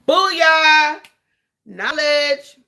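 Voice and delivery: loud, high, drawn-out whoop; playful high-pitched victory cry; triumphant shout. Real, not acted. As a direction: holler it like scoring a point. Triumphant punchline after her argument.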